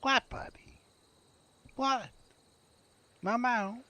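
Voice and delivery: squeaky high voice for the pet; high baby-talk tease. Acted. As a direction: tease the little one in a high voice.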